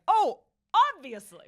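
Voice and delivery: mock realisation. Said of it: "I'm like".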